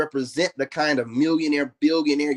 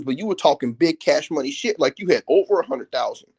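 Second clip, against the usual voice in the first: ordinary talk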